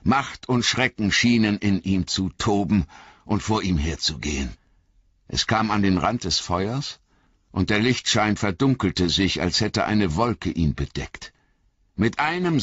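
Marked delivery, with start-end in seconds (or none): none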